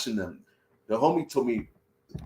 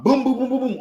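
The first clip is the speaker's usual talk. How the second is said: loud, high, rapid pounding booms; imitated police knock; 12 semitones higher, 6 dB louder than usual. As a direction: hammer out the knock with your voice. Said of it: "like, a police knock".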